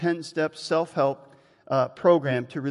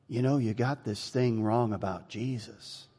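softer, lower retold voice; quiet correction; 5 semitones lower, 8 dB quieter than usual. Lady correcting the young preacher, quiet and low. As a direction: gently point out the mistake, low.

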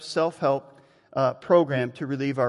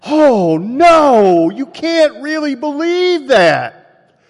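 high, loud, drawn-out cry of disbelief; exaggerated dismay; 10 semitones higher, 11 dB louder, much slower than usual. Preacher reacting to the marijuana answer, loudly appalled. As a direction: wail it in hammed-up shock. Acting out shocked second reaction.

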